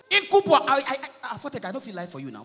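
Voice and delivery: normal talking voice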